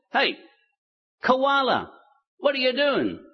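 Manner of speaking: high-pitched story lizard calling up a tree. Acted. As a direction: call up to someone overhead, voice pitched high. Lizard calls up to koala in tree.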